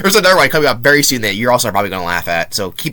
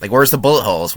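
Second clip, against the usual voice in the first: ordinary delivery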